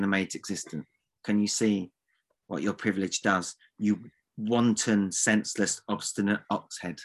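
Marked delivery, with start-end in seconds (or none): none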